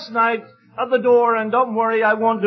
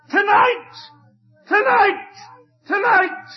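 crying out; 11 semitones higher, slower than usual. Retold or imitated. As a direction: blurt it out as a sudden crying release. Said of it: "he just let out this cry".

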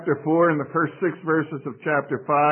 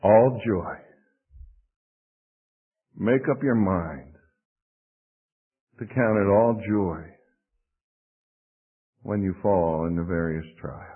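low, measured, with long pauses; unhurried, low-voiced counsel; calm, cool resolve; 5 semitones lower than usual. Real, not acted. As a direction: let each phrase settle calmly before the next. Preacher models calm, cool resolve.

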